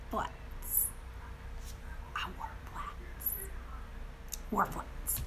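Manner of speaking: near-whispered rueful admission; very soft, voiceless aside; hushed confession. Real, not acted. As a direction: drop to a whisper to own up. Confessing her shoe mistake.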